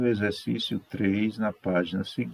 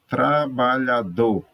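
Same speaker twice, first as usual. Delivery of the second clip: slow, louder Tra-ba-lha-dor; syllable by syllable; 3 semitones higher, 5 dB louder, slower than usual. Shown as a demonstration. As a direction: sound out "Trabalhador" slowly for a class. Teacher announces word to split. ("Trabalhador" devagar e forte, sílaba por sílaba)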